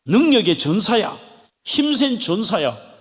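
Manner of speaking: ordinary talk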